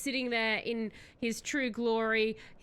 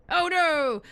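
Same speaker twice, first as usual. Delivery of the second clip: loud, higher cry of alarm; alarmed outburst; 6 semitones higher, 7 dB louder than usual. Acted. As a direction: yelp as Jeff nears Perry. Russian protects kidnapped Perry.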